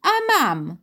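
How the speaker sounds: stamp your foot on it; defiant little boy insisting. A narrator's reading. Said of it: "tupnął nogą Piotr".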